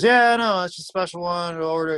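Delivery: mock innocent high-pitched fib; high nervous cover-up. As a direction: blurt it out high like you're hiding something. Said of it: "And he goes".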